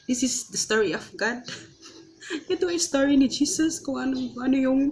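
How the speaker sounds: tearful, pitch rising, voice cracking on God's story; fighting back real tears; voice breaking. Real, not acted. Emotional faith testimony, voice breaking. (nangingiyak na boses)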